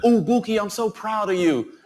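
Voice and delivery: high-pitched cooing of a proud friend; put-on high voice, softening at the end; proud homegirl. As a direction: imitate a friend gushing with pride. Voices his delighted homegirl.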